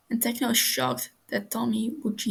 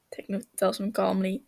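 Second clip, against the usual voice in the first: ordinary delivery, nothing marked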